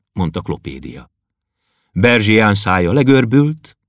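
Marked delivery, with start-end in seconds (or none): none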